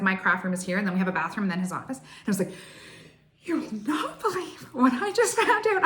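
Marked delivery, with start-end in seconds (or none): 3.4-5.8 high, laughing, bursting with news; excited burst. Retold or imitated. Bursting into her husband's office with big news, giddy and laughing. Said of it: "I was so excited".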